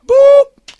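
high, loud "boop"; imitated censor bleep. Dad censoring his child's cuss word, high bleep. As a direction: pop out a mock bleep.

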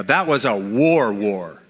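"war, war" as a weighty falling echo; emphatic repetition. Shown as a demonstration. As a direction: stress "war", then drop away on the repeat. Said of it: "is used for force or emphasis".

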